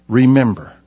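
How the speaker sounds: ordinary talk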